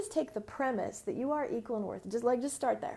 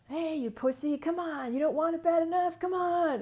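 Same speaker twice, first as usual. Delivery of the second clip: louder, higher, fast barking coach taunt; mock coach yelling; 6 semitones higher, 6 dB louder than usual. Old-school coach yelling at his high school players, mock harsh shout. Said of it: "coaches would tear down their high school players".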